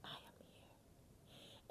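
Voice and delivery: a hushed surrender to God; whispered prayer. Retold or imitated. To the congregation, reliving her prayer: fading whisper. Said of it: "I was like".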